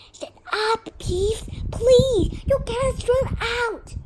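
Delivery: high, pressing plea; urgent plea. A narrator's reading. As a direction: beg him to shut up, pitched high. Pleading with a noisy troublemaker.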